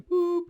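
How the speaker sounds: high siren "boop"; imitated siren. Storyteller punctuating the question to the prince, high imitated siren. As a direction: pop in one short, very high siren note. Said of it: "what is going on with the siren".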